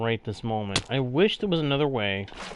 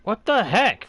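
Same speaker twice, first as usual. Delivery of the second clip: sharp, higher, louder what the heck; startled outburst; 8 semitones higher, 6 dB louder than usual. Real, not acted. Gamer hit by a sudden glitch, startled and loud. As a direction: caught off guard by something odd.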